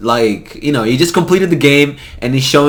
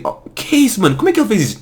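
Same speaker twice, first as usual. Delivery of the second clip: high, softer amazed oh que é isso; 8 semitones higher, 4 dB quieter, much faster than usual. Retold or imitated. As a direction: marvel quietly at the trick. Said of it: "que eu estou a dizer assim".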